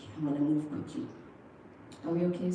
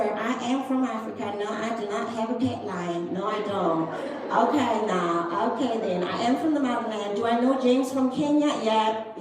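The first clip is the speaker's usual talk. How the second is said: hammed-up American call center voice, higher pitched; fake American accent; 4 semitones higher, 5 dB louder than usual. South African agent fielding callers' questions, exaggerated fake American accent. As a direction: put on an American accent, growing louder as it goes. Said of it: "pretending to be an American".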